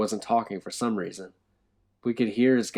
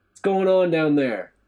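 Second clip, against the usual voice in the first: loud call down the stairs, higher; shouting; 9 semitones higher, 9 dB louder than usual. A narrator's reading. Dante shouting down the stairwell, loud. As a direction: shout down to the men below. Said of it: "Dante shouted".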